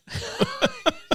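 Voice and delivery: high squealing laugh breaking out; burst of laughter. Real, not acted. Laughing at her own filthy gun, a high squealing burst of laughter.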